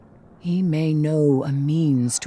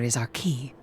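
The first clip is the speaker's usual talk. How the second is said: whisper; 3 semitones lower, 10 dB quieter than usual. Acted. Hushed plotting over Oryx's sword.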